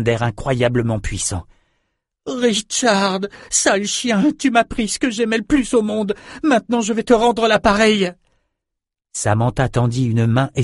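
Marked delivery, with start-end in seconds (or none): 2.3-8.1 put-on high, furious young girl; vengeful rage. A narrator's reading. Samantha vows to pay Richard back, high vengeful rage. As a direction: threaten revenge in a high, raging voice.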